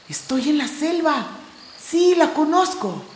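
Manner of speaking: a little creature recognizing the jungle; small explorer voice. Acted. Core in the jungle, awed and a touch excited.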